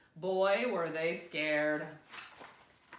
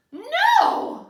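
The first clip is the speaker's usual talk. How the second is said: high, loud panicked no; yell; 16 dB louder than usual. Acted. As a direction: scream it in terror. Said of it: "I yelled".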